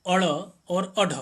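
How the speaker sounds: slow, careful अड़ and अढ़ with a short अ before the flapped sound; demonstrated pronunciation. Shown as a demonstration. Teacher showing students how अड़ and अढ़ are said, slow and careful. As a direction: say अड़ and अढ़ slowly, with the अ clearly first. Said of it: "जब हम इसका उच्चारण करेंगे".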